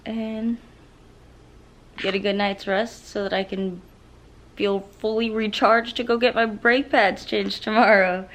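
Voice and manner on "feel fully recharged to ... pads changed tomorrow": ordinary talk, nothing marked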